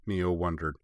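ordinary delivery

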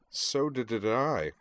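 slow, lightly stammered chat to a friend; mild stutter. Acted. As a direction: trip only on first sounds. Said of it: "Kate's stutter".

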